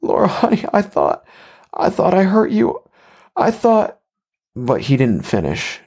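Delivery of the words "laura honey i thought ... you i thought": high-pitched tearful pleading; high, acted sobbing voice. A narrator's reading. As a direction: narrator acting a distraught man, voice pushed way up. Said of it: "Now sobbing and stepping forward".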